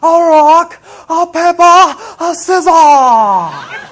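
put-on high, full-blast boyish yelling; imitating kids' gleeful high-pitched yells; yelling at top of lungs. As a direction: holler it at the top of your lungs, drawing out the last word. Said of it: "yelling at the top of their lungs".

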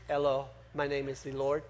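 low, soft Latin lover voice; sexy Spanish voice. Pretend. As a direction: purr it like a suave Spanish lover. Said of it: "one of those kind of sexy Spanish voices".

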